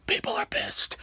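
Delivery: hushed, voiceless aside; dramatic stage whisper. Retold or imitated. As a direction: whisper it like a warning. Hushed refrain about angry fans.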